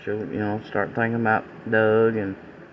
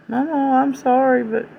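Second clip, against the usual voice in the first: put-on high, louder tearful little girl's plea; much higher weeping child voice, imitated; imitated child crying; 14 semitones higher, 3 dB louder than usual. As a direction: play the sobbing grandchild begging to keep her buddy. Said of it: "I mean, just crying".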